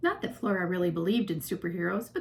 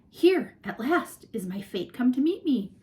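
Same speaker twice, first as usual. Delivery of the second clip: mock-grand thought; 4 semitones higher, 5 dB louder than usual. A narrator's reading. Mock-dramatic thought squirrel didn't have.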